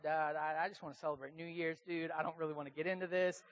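low, halting imitation of someone cornered; mock stammering reluctance. Pastor cornered by a party guest on New Year's, mock flustered reluctance. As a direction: fumble for words in a low voice, backing out. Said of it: "I was just like".

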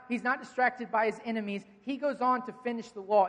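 ordinary talk, nothing marked

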